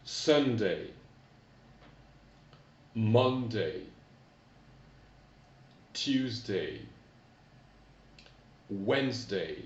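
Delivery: each day said slowly and clearly, falling; teacher modelling Sunday, Monday for repetition; model English pronunciation. Shown as a demonstration. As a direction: say each weekday for pupils to repeat. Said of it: "vous répétez après moi".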